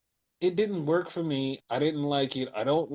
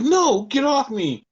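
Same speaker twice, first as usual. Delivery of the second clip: higher, louder, slow hammed-up protest; mock pleading refusal; 8 semitones higher, 6 dB louder, slower than usual. [name] pushing Izzy away, slow high mock protest. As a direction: play the wronged heroine.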